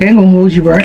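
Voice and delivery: normal talking voice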